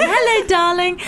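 high, laughing 'hello, darling'; playful high-pitched hello through laughter; darling greeting. Shown as a demonstration. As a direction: greet a forgotten name with showy affection. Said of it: "That's what I like".

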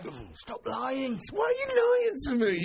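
high wailing mock upset; hammed-up wounded wail; mock accused crying.

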